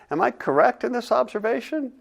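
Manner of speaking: plain reading voice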